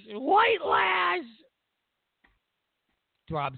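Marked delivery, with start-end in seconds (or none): 0.1-1.2 mocking squeal. Repeated much higher, mocking.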